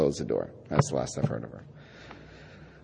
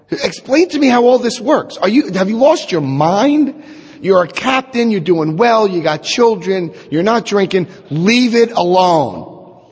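a lightly voiced mother, higher and pleading; mother's voice; 6 semitones higher than usual. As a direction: play your worried mother, pitched up and drawn out at the end. Voices his worried mother.

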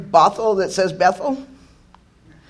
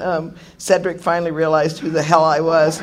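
usual speaking voice

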